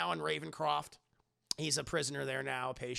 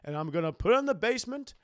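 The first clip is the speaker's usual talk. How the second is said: Aunt May voice; 8 dB louder than usual. Retold or imitated. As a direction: lecture him like a no-nonsense aunt. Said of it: "Aunt May speech".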